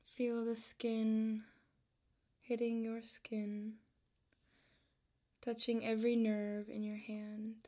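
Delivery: calm, unhurried voice with long pauses between phrases; measured, even-toned meditation guide; guided meditation. Real, not acted. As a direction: ease the listener into relaxation, leaving room to breathe.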